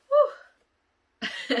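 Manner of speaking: very high, loud woo; emotional woo. Real, not acted. Vlogger releasing emotion to her viewers. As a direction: let the emotion burst out. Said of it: "emotional release".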